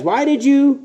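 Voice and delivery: high, exasperated "what are you saying"; mock the disciples' confusion; bewildered disciples. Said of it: "They were kinda crazy".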